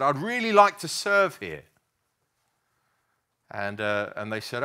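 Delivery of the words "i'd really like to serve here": put-on keen young musician asking to serve, much higher and louder; eager request. Young musician asking the church leader to serve, eager and high. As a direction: ask eagerly, almost bursting.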